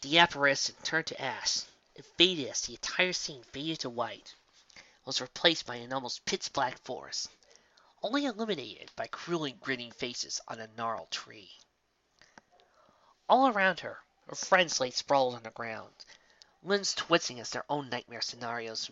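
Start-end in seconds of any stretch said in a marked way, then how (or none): none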